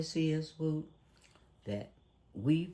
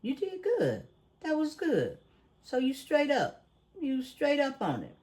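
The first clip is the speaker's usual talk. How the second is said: playful praise; 11 semitones higher than usual. Real, not acted. Host praises co-host for pronouncing acetaminophen, playful high-pitched praise. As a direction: praise them in a high, teasing coo.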